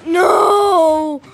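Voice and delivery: loud, high, drawn-out no; long frustrated shout; shout of defeat. Real, not acted. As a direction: yell it out as the game is lost. Loses at the game.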